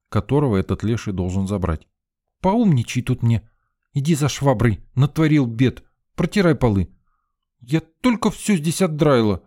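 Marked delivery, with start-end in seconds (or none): 2.4-9.4 put-on high bossy girl's voice; scolding girl. A narrator's reading. Sveta scolding the narrator about the mess. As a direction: boss him around, sharp and high.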